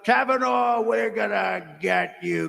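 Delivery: imitating Schumer shouting a threat; mock Schumer shout. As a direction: bellow it like a protester on the courthouse stairs. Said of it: "when you heard Schumer get up ... and talk about".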